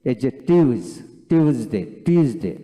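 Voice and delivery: টিউজডে said with a buzzing z, not s. Shown as a demonstration. Teacher corrects the Tuesday sound, firm and clear. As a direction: lean on the z in টিউজডে. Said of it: "এইভাবে টিউজডে উচ্চারিত হবে".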